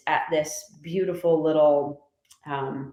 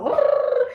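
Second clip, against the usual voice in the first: high rolling rrr of frantic writing; playful high growl of excitement; frantic scribbling growl. Retold or imitated. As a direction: mimic a furious burst of writing. Imitated writing frenzy noise.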